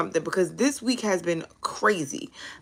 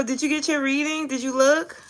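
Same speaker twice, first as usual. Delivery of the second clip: usual speaking voice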